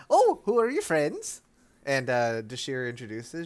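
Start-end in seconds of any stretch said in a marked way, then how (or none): 0.1-1.4 put-on high, slow old lady question; granny voice. Granny asking about Dashir's friends in a high voice. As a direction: ask sweetly in a high granny voice.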